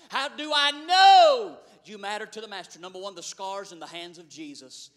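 loud, rhythmic preaching cadence. Real, not acted. Preacher proclaiming the scars of Jesus to the congregation, loud. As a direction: hammer each line home to the church. Sermon at full force.

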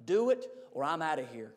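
a hushed low warning from above; God quietly threatening to leave. Retold or imitated. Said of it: "God, that's my shopping money".

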